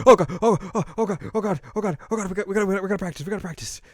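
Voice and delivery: high, breathless stream of repeats; mock panic. New member finds nobody practicing, hammed-up panic. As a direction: tumble over the words in comic panic.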